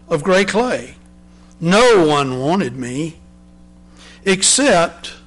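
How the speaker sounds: usual speaking voice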